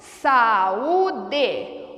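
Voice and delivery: "Saúde" split slowly into sa-ú-de; syllable by syllable. Shown as a demonstration. Teacher breaking the word saúde into syllables, slow and separated. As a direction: sound out "Saúde" in three drawn-out beats. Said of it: "Veja a palavra saúde".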